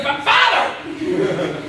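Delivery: high, insistent cry for attention; exasperated cry. Acted. Son trying to interrupt his father's embrace, exasperated cry. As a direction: cut through the hugging with a high "Father!".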